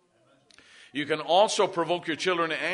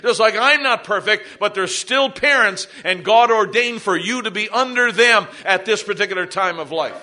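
loud, emphatic pulpit delivery; full-force preaching; 5 semitones higher, 9 dB louder than usual. Real, not acted. Pastor exhorting parents and children in church, full-force preaching. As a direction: drive each point home to the congregation.

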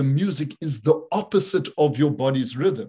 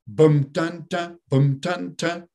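spoken waltz drumbeat, "bum" heavier than two light "dun"s; main-beat drum demo; 2 semitones higher, slower than usual. Shown as a demonstration. Teacher showing main beat and two offbeats, waltz drum demo. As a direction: chant a drum pattern in threes, first beat strong. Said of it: "you had your main beat and you got two offbeats".

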